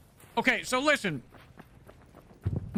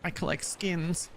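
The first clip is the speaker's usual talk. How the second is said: creepy skin collector; 3 semitones higher, 4 dB quieter than usual. Pretend. Creepy stranger voice again.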